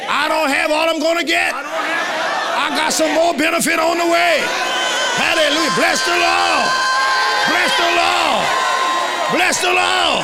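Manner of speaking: high, shouted pulpit exhortation; full-force preaching. Real, not acted.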